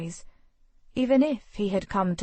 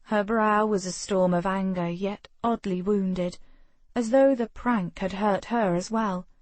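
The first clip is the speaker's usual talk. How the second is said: ordinary delivery, nothing marked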